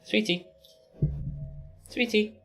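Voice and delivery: very high-pitched sweet-talk to a pet; coaxing pet talk. Real, not acted. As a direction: coax a pet over in a tiny high voice.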